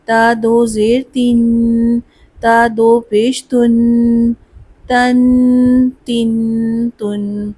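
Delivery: slow, spelled-out drill for students to repeat; slow drill recitation. Shown as a demonstration. Teacher leading a spelling drill, slow. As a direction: chant each syllable slowly. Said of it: "آئیے اب اس کی مشق کریں".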